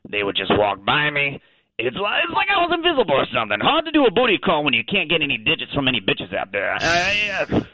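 casual Australian talking dog; chatty Aussie caller, thick Australian accent. Acted.

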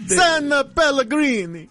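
high, hammed-up Italian shout; mock Italian accent. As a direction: fake Italian cry, hammed up. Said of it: "stereotypical Italian foods".

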